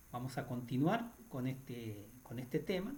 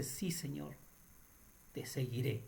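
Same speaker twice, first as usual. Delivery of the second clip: ordinary delivery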